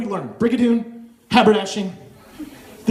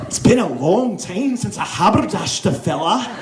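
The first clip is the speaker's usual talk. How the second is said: put-on lilting, swooping Scottish accent; hammed-up Scottish accent; fake Scottish accent. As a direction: lean into a sing-song Scottish accent. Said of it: "I've just validated my Scottish heritage".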